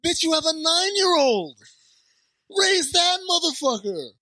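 high, loud scolding shout; outraged yell. Retold or imitated. Co-host yelling at the movie mom about her kid, outraged. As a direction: holler it at a neglectful parent, drawn out at the end.

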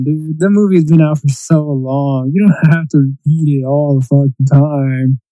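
ordinary talk, nothing marked

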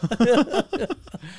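real burst of laughter; laughing. Real, not acted. Cracking up after imitating the wife, laughing. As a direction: laugh at your own joke.

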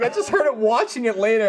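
ordinary delivery

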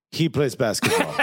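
deadpan, low-pitched Latvian doctor; emotionless doctor. Retold or imitated. Latvian doctor sizing up a patient, emotionless. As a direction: imitate a doctor with no emotion at all. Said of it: "No emotion in this woman".

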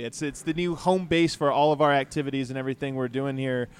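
normal talking voice

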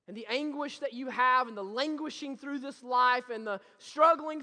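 loud, high, impassioned sermon climax; full-force preaching. Acted. Pastor rousing the congregation, loud impassioned preaching. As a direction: build it up and preach it hard.

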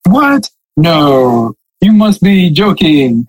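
put-on sarcastic amazement; sarcastic mock disbelief. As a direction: feign astonishment at old news, stretching it out. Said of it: "that's me being sarcastic".

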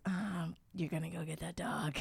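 very soft, low-key retelling; quiet mock resignation; imitated knowing reply. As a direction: say it hushed, as a knowing partner. Retold partner's knowing reply.

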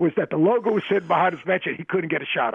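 lightly mocking his complaint. Mimicking JR's complaint.

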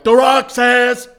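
loud, slow wrestler catchphrase; Stone Cold Steve Austin. Acted. Host mimicking a wrestling figure's sound chip, wrong wrestler's booming voice. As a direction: bellow it high and drawn out like a wrestling toy. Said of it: "You just did Stone Cold's voice with The Rock".

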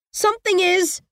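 put-on high, worried child's voice; scared girl. A narrator's reading. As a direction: answer small and frightened. Trapped girl answers.

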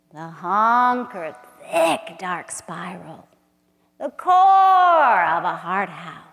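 slow, intimate poem recital with hushed drops; dramatic spoken-word reading; poetry reading. Acted. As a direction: read it like a love incantation. Reads her poem aloud.

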